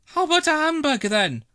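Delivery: put-on high, quick boy voice; sly boy. Norman offering Trevor sweets, sly and quick. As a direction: slip him the offer slyly.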